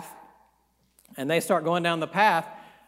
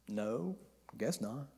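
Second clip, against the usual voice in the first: sheepish mumble; 7 semitones lower, 14 dB quieter, slower than usual. Retold or imitated. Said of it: "Balaam says".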